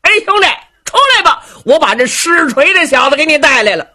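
fast, high call into the teahouse; the fleeing warrior calls his brother out of the teahouse, fast and high. Acted. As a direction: shout quickly to someone inside. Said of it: "冲着茶馆里头喊".

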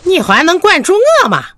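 put-on high, quick challenge to a controlling child; defiant retort. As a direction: fire back fast, pitch jumping up. 母亲执意回家，顶撞子女. (倔强顶嘴，又高又快)

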